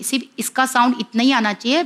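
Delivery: ordinary delivery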